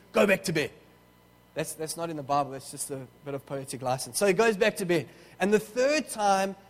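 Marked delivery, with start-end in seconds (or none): 0.1-0.7 mildly irritated mock order; tense, cranky. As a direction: send them off like a cranky sleeper. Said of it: "Eli starts getting a bit tense".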